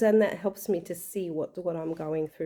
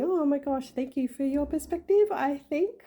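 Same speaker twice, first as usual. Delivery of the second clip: laughing; 8 semitones higher than usual. Retold or imitated. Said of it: "cracking up laughing and saying".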